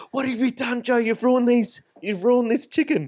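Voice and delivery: high-pitched outraged imitation; mock furious rant. Retelling a friend's fury over ruined chicken wings, mock furious. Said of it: "Oh, he got so mad".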